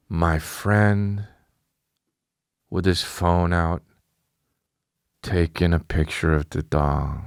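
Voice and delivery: low, drawn-out disbelief; slow disbelief. Retold or imitated. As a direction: spell out the outrage slowly, pitched low. Slow, disbelieving reveal of friend.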